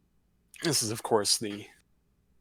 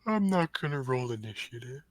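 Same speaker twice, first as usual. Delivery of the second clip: sleepy muttering; 4 semitones higher than usual. Acted. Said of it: "You hear him mutter in his sleep".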